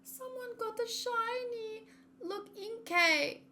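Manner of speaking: high, slow, gushing delight; excited gushing. Real, not acted. Streamer gushing to chat about a shiny, high and slow. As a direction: coo over something adorable, drawn out.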